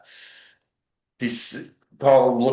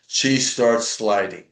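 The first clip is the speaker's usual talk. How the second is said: ordinary delivery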